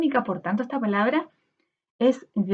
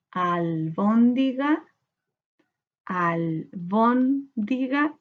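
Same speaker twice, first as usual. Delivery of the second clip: "albóndiga" very slow in even pieces, al-bón-di-ga, rising; syllable by syllable; much slower than usual. Shown as a demonstration. Teacher counting syllables with pupils, "albóndiga" slowly syllable by syllable. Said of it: "¿Cuántas sílabas tiene?".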